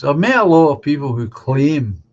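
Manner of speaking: emphasis placed on "claim"; stress on CLAIM. Real, not acted. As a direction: lean on the word "claim" at the end. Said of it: "I put this emphasis on the word claim".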